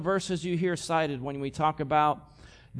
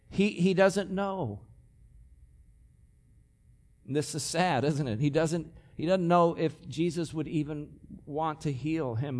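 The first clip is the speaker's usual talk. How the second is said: ordinary talk, nothing marked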